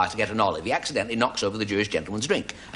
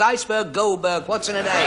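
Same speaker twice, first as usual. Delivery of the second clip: higher, punchline retort; mock retort; 10 semitones higher than usual. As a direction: toss off the punchline. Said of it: "Chinaman says".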